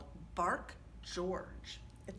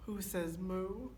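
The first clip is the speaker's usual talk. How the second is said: ordinary delivery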